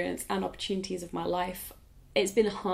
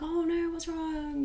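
concerned; 9 semitones higher, slower than usual. Retold or imitated. Reacting to a crying girl.

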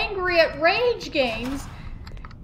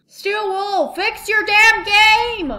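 angry yell; 6 semitones higher, 6 dB louder than usual. Real, not acted. Yelling at developer.

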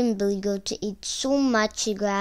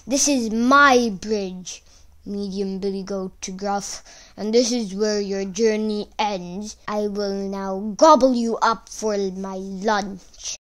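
troll voice. Acted. Troll blocks the goat.

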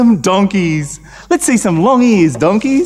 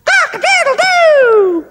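high, slow, stretched rooster crow; 3 dB louder, slower than usual. Acted. Storyteller voices the rooster on the ark, crowing high. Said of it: "and the rooster went".

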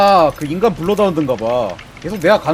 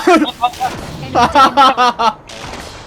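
laughing; 5 semitones higher than usual. Real, not acted. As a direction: burst of gleeful laughter. Laughs after downing an enemy.

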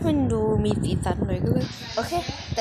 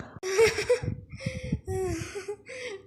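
high giggle; 10 semitones higher, 4 dB quieter than usual. Real, not acted. Laughs at brother's walk.

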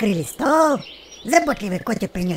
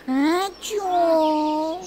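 a light cat purr, higher; 5 semitones higher than usual. A narrator's reading. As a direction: purr the word like a pleased cat. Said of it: "промурлыкал им в ответ Котя".

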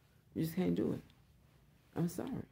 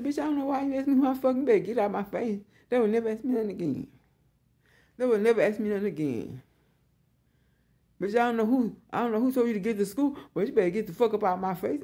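loud, cussing tell-off, higher pitched; retold angry yelling; 3 semitones higher, 7 dB louder than usual. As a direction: yell it like you're chasing her off. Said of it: "I said".

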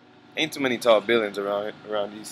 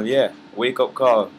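loud, punchy promo call-out; hyped plug; 3 semitones higher, 8 dB louder, slower than usual. Real, not acted. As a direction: announce it loud and proud. Hyping his upcoming release to viewers.

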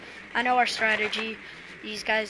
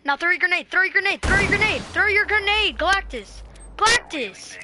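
frantic shouting; 8 semitones higher, 8 dB louder than usual. Real, not acted. Urging teammate to throw grenade.

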